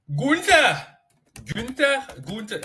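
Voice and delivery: Gunther lancé très aigu, à l'allemande; accent allemand. Pretend. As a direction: Gunther dit très aigu avec un accent allemand, puis qui retombe. Cherche un nom allemand. (accent allemand, Gunther très aigu)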